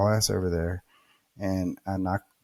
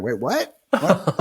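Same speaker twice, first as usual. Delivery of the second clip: confused shock; 7 semitones higher, 4 dB louder, much slower than usual. Retold or imitated. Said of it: "I'm like".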